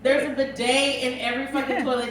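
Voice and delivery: ordinary talk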